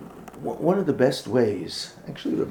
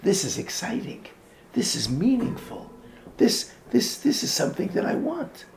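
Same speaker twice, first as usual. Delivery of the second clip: rising higher, eager joy; mock excitement; 6 semitones higher than usual. Children feeling Torah's joy, lightly voiced excitement. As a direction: sound thrilled about it. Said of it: "they got that sense of".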